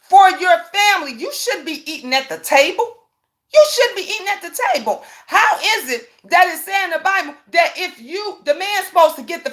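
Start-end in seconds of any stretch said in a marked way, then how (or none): 3.5-5.0 higher, quicker, near tears; emotional repeat. Real, not acted. Mother insisting on her rule, voice cracking. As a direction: push it out as feeling breaks through. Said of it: "repeated, voice breaking".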